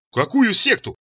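high-pitched puzzled question; bewildered babble. A narrator's reading. Son baffled by mother's sect accusation, babbling. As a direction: blurt it weakly, taken aback. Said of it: "пролепетал я".

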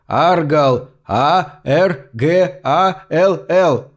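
Аргал, then А Р Г А Л Л spelled slowly letter by letter; spelling out name. A narrator's reading. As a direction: spell the name into a dead ear, drawn out. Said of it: "закричал он в мёртвое ухо". (медленно по буквам: А Р Г А Л Л)

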